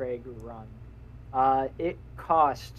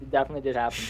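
talking while chewing candy; words round a mouthful of Skittles; mouth full; 5 dB quieter than usual. Real, not acted. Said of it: "chewing on Skittles right now".

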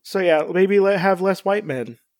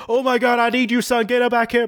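higher, urgent mock panic; hurried plea voiced in a raised pitch; imitating Odin; 6 semitones higher than usual. Odin begging his son to come back, mock-frantic. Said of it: "Odin was just like".

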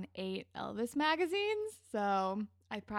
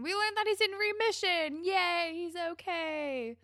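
cheering good news; 8 semitones higher, 3 dB louder than usual. Real, not acted. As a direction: sing out the happy news, stretching the cheer. Excited news of remission with cheer.

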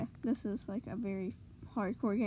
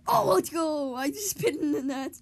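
loud, higher excited cheer; goal celebration; 3 semitones higher, 6 dB louder than usual. Real, not acted. Gamer celebrating a shot in the net, excited. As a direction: let out a big, loud cheer.

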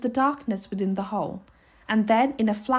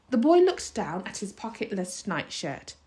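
ordinary delivery

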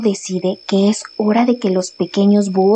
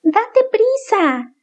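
high, slow smiling owlet; 11 semitones higher, much slower than usual. Acted. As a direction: say it with a grin. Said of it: "dijo el otro sonriendo".

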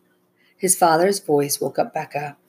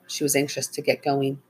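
ordinary delivery, nothing marked

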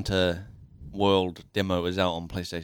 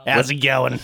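bright, louder character greeting; 4 semitones higher, 7 dB louder than usual. Acted. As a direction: greet them as the showman. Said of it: "This is my character voice".

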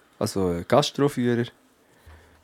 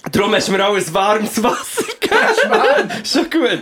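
high, breathless laughing talk; 7 semitones higher than usual. Real, not acted. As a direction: laugh through every word. Laughing about warm water.

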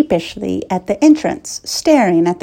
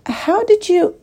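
stunned question; 12 semitones higher than usual. A narrator's reading. Princess astonished, cut off.

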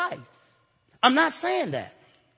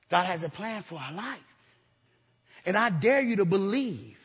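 normal talking voice

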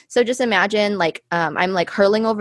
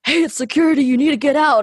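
raised, higher bouncer voice ordering her out; stern security guard; 6 semitones higher, 4 dB louder than usual. Retold or imitated. Security guard ordering a drunk girl out of the stall, raised and firm. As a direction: bark it like club security at the door. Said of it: "they're like".

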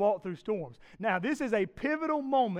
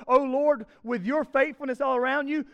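louder, higher declaiming of the psalm; proclaiming scripture; 6 semitones higher, 8 dB louder than usual. Shown as a demonstration. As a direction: proclaim it to the congregation. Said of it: "verse nine says this".

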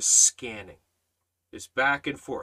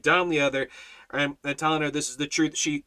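ordinary delivery

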